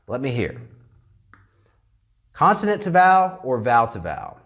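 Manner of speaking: normal talking voice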